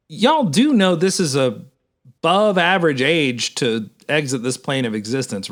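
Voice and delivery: usual speaking voice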